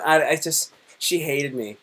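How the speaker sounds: usual speaking voice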